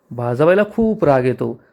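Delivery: ordinary delivery, nothing marked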